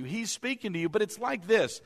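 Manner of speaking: ordinary talk, nothing marked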